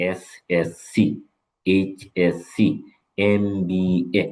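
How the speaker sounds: slow, spelling out each letter. Shown as a demonstration. Teacher spells abbreviations slowly for students, letter by letter. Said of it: "letter by letter পড়া যায়".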